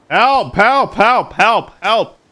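loud desperate yells for help; screaming for help. Real, not acted. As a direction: shout for help, panicking. Character falling, calling for help.

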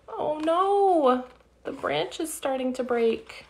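plain reading voice

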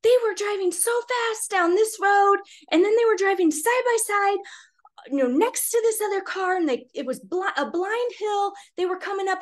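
high-pitched retold rant; going off. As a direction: imitate an upset neighbour going off about reckless driving. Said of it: "He starts going off about how".

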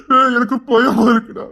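slow, tearful plea to his friend; scared father sobbing to his friend; crying fear. Acted. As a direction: break down as you admit the fear. Father fears for daughter.

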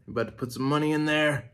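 usual speaking voice

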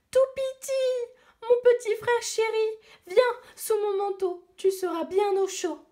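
put-on high, affectionate little girl's voice; tender big sister. Girl welcomes her rescued baby brother, tender and high. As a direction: call her baby brother close with relief. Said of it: "elle s'écria".

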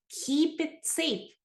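teacher models a key phrase slowly, careful and clear; slow model reading. Shown as a demonstration. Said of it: "이것도 잘 알아두세요".

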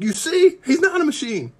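high, loud rising corner-man cheer; coach urging Rocky. Retold or imitated. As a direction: fire up your fighter from the corner. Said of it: "the guy in the corner, Rocky's coach".

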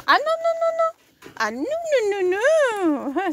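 sharp high-pitched no, no, no; loud high no. Real, not acted. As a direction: call out a loud, high stop. Stopping animal, loud high.

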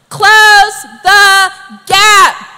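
loud, very slow, word by word; slow preacher's shout; emphatic exhortation. Real, not acted. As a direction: drive each word home at full voice. Preacher drives home his exhortation.

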